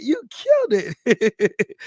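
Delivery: slow, laughing through the words; laughing praise. Real, not acted. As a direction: let it melt into a laugh. Praising a viewer's weight loss.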